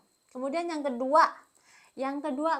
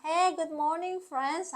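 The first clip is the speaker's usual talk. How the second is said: high, drawn-out cheery hello; cheerful mock greeting; 6 semitones higher, 4 dB louder, slower than usual. Learner practising English alone at the mirror, cheerful mock greeting. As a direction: greet imaginary friends with big, slow enthusiasm.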